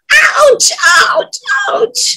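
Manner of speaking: high repeated yelps of pain; acted high-pitched ouches. A narrator's reading. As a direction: yelp like a child who just fell. Boy falls off swing.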